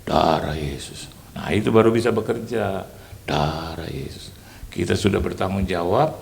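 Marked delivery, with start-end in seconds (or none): 0.0-1.1 slow reverent. Shown as a demonstration. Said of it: "tapi hati saya gini". 3.2-4.3 low, slow and hushed, a reverent murmur; hushed reverent prayer; slow reverent whisper. Shown as a demonstration. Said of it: "tapi hati saya gini".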